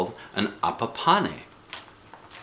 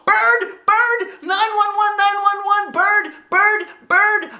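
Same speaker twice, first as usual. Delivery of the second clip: put-on much higher, louder child screech; panicked screech; 6 dB louder, slower than usual. A narrator's reading. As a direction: scream for help in a panic. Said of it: "I screeched".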